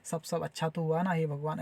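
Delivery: low, soft acted appeal to God; hushed, lightly acted worry; murmured worried prayer. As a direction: drop pitch and volume as if praying privately. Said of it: "ऐसा type से".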